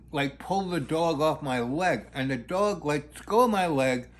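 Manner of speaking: normal talking voice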